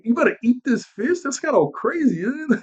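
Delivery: high, disbelieving mock question; rising incredulous squeak. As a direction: ask it like you can't believe it. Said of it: "And I was like".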